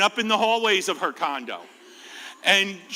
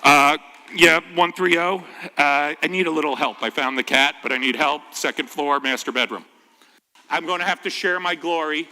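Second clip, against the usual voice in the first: low, clipped radio voice; mock radio call to the chief; 5 semitones lower, 3 dB louder than usual. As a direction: talk into the radio like calling the chief. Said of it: "and I'm like".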